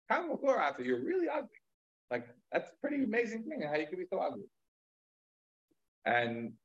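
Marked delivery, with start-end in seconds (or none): 0.1-4.4 higher, mocking jeer at the ugly man; scornful rabbi. Rabbi sneers at the ugly man, higher mocking voice. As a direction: sneer it out from above.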